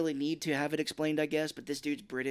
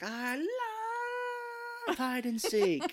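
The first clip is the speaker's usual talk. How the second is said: high, slow squealing delight; delighted Fred; slower than usual. Retold or imitated. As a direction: gush like an overexcited child. Imitating Fred's delight.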